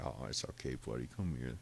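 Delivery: calm the hurt cat gently; tender comfort. Retold or imitated. Owner comforting his hurt cat, soft soothing voice.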